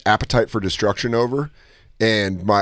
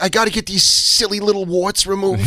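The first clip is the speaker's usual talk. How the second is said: high, whiny character voice; high-pitched prank caller; Saul Rosenberg; 5 semitones higher than usual. Retold or imitated. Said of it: "his first character is Saul Rosenberg".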